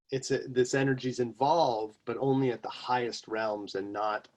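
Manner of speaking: ordinary talk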